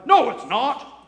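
a loud, sharp mock protest; a churchgoer bristling at the pastor; indignant retort. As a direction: push back hard, as the offended listener. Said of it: "I was talking to somebody about tithing".

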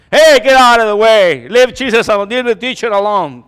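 loud sharp shooing; shooing disciples. Retold or imitated. Preacher voices disciples shooing the children from Jesus. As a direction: shout the kids away.

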